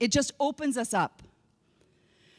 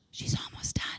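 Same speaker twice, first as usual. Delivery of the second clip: hushed unvoiced aside; whisper; 8 dB quieter than usual. Real, not acted. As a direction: whisper it under your breath. Aside whisper during speech.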